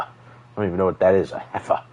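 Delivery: ordinary talk, nothing marked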